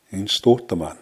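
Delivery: "In stohtaman" with "se" squeezed to a bare s; "In stohtaman" elided, short vowels dropped; contracted form. Shown as a demonstration. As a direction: run "In" straight into "stohtaman". Short I's are often deleted.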